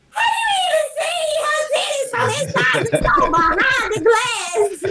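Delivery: high, laughing near-shout of disbelief. Retold or imitated. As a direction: squeal it out while laughing. Retold friend's disbelief, laughing.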